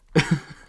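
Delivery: loud, high burst of laughter; amused high chuckle; short laugh. Real, not acted. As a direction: let out a quick loud laugh. Laughs at odd sight.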